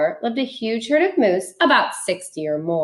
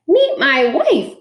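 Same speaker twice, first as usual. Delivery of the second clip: proud bird voice; 7 semitones higher, 5 dB louder than usual. Acted. Bird introduces wife proudly.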